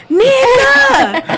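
high, drawn-out holler; imitated woman's yell. Ex yelling for him from outside, high mimicked holler. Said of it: "she was like".

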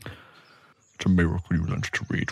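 low, slow orc voice; teasing her child, low and unhurried; orc mother. Acted. Orc mother answers her bookish child, low and slow.

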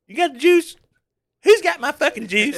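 high, loud hillbilly squeal; hammed-up high West Virginia voice; mock Jimbo Fisher. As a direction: screech for your juice like a hillbilly coach. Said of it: "you remember that story".

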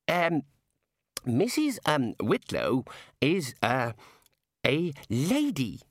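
slow, halting, um-filled explanation; awkward hesitation. Acted. Ponder explaining Missus Whitlow to the god, awkwardly. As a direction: pick each word with embarrassed care.

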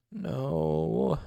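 small, drawn-out despairing plea; hopeless, despairing cry. A narrator's reading. Patient refusing to leave the room, in a despairing cry. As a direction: cry it out without howling, hopelessly. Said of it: "Wynne gave a hopeless, despairing cry".

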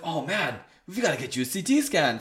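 put-on butchered European accent. Doctor ordering a scan, lightly mocked European accent. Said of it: "sorry for butchering his accent".

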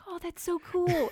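high, drawn-out gushing; mock enthusiastic. Quoting a friend's text reply, slow mock enthusiasm. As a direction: stretch it out with exaggerated admiration.

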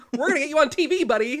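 high, loud cheesy pitch with a laugh; laughing sleazy agent hype; cheesy salesman. Acted. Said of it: "And he talks like this".

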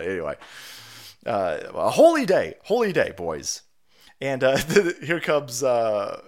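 ordinary talk, nothing marked

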